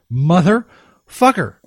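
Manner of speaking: slow, stretched motherfucker; slow fed-up curse; drawn-out curse. Shown as a demonstration. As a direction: drag out the curse in exasperation. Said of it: "repeats the curse".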